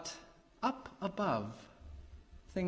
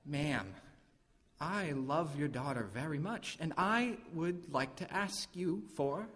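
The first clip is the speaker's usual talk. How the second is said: soft, sincere, careful request; gently and formally ask permission; earnest appeal; 8 dB quieter than usual. Acted. Suitor asking the mother for her daughter, earnest appeal.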